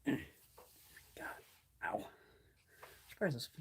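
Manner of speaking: ordinary talk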